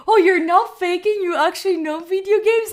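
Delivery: high, loud disbelief; incredulous retelling of his surprise; mock shocked. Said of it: "he was shocked".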